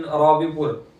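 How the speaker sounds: ordinary talk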